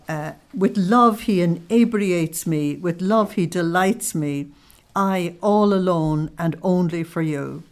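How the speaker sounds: slow, fervent prayer reading; devotional reading. Acted. Teacher reads the mystic's prayer to Jesus, slow and fervent. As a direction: read it like a prayer, savouring each line.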